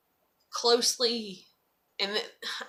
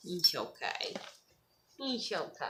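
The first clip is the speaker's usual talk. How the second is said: soothing the dog; 5 semitones lower, 10 dB quieter than usual. Real, not acted.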